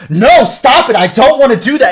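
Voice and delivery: imitating a distraught man yelling; high, very loud, acted-out plea; retold bawling protest. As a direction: cry out in protest at full volume. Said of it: "fucking crying and bawling while he's doing this".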